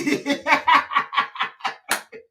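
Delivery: airy giggling fit; can't hold the laughter in; breathy laugh. Real, not acted. Host laughing at a viewer's comment on accents. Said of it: "please don't make me laugh".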